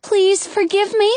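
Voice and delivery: high pleading call. A narrator's reading. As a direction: plead down from the window. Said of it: "Rapunzel calls down".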